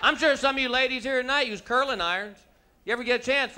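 ordinary talk

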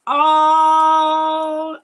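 a loud, high oh of delight; surprised loud oh. Real, not acted. Host spotting the winner's comment, loud surprised oh. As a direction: burst out with a surprised oh. Name drawn, she spots winner.

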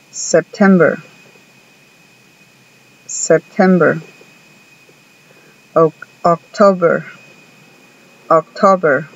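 month names said clearly, English R endings; model pronunciation. Shown as a demonstration. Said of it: "Pongan atención otra vez".

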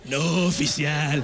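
playing sober badly; lightly voiced stoned driver; stoned suspect. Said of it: "te pararan y dijeran".

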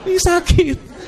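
high-pitched whine of complaint; mock protest. Sick patient answers a selfie request, mock whining.

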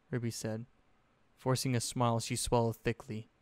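usual speaking voice

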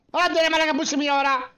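impatient call. Acted. Impatient call to late friend.

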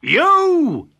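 high, loud accusing shout; the woodcutter confronting the wolf; loud cry. Acted. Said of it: "he cried".

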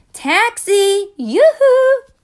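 very high loud call; hailing a taxi. Acted. New York hailing a taxi, very high loud call.

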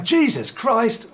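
exasperated exclamation. Retold or imitated. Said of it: "do you not find yourself going".

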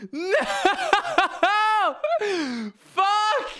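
high, anguished cry breaking into laughter; real high-pitched exasperated outburst; laughing wail. Real, not acted. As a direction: wail in dismay, half laughing. Laughing dismay at own blunder.

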